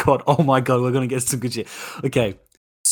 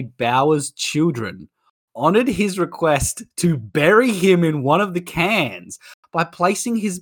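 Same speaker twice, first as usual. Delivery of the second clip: normal talking voice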